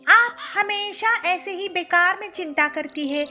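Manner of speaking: high daughter-in-law voice; young bird daughter-in-law. A narrator's reading. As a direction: show off the bargains brightly.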